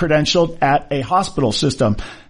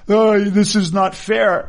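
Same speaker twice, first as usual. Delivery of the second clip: drawn-out, higher-pitched mock whine; mock crying; 4 semitones higher, slower than usual. Doctor protesting to colleagues at hospital meeting, mock crying. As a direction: imitate a doctor whining in tears. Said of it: "one of the doctors started crying".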